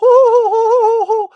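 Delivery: high, loud falsetto "ohoho" laugh; cartoonish high-pitched giggle; mock squeaky giggle. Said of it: "That's how he talked".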